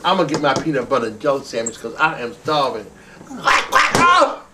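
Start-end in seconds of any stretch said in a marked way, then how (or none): 3.3-4.4 high, sudden duck quacks; squawky duck call rising high; duck quacking. Pretend. Duck puppet makes itself heard.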